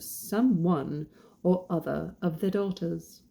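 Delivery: put-on storybook narration in a British accent. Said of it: "read it with a British accent".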